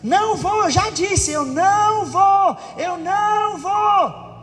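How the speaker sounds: high stubborn shout, stretching into slow drawn-out words; insistent, escalating. Acted. Quarrelling person refuses to buy bread, insistent and escalating. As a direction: build the refusal until it drowns out argument. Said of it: "brigando e fica insistindo e aumentando".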